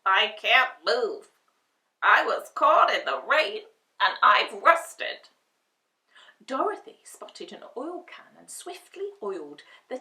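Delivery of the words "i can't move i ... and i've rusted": stiff Tin Man. Acted. Rusted Tin Man voiced.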